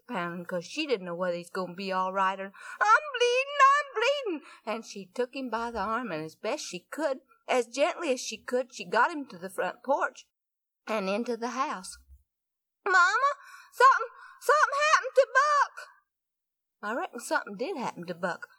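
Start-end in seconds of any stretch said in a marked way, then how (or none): 2.8-4.5 shrieking in terror, much higher and louder; a hurt little boy wailing in panic; crying out high and shrill with fear; panicked screaming. Acted. 12.9-15.9 high, loud, tearful rush of words; much higher and louder, fast and crying; panicked crying call. Acted. Girl bursts in crying for mother.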